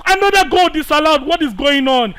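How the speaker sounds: outraged. Real, not acted. Second goal disallowed.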